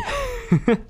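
high giggling burst; laugh. Real, not acted. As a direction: crack up at your own innuendo. Laughs at own double meaning.

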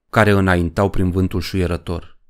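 usual speaking voice

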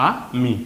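"আ মি" split in two, a gap after আ; broken into syllables. Shown as a demonstration. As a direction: say আ, pause, then মি. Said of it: "যেভাবে ভেঙে পড়ছি". ("আ মি" ভেঙে ভেঙে পড়া)

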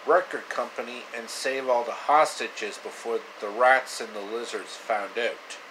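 ordinary delivery, nothing marked

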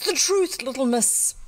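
high, loud insistent command; chorus demanding. A narrator's reading. Dozen gentlemen question the little girl, high loud demand. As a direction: demand it like a crowd of interrogators. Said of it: "said a dozen voices".

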